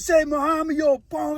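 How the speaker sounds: high-pitched jeering imitation; mock taunting. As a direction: needle a rival through the bars. Retold taunting through prison bars.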